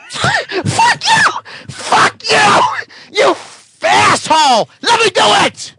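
enraged at being interrupted; furious shout. Real, not acted. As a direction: scream at the heckler. Furious at heckler.

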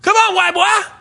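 high, loud taunt; taunting call. Retold or imitated. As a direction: goad him to come outside. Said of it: "And they said".

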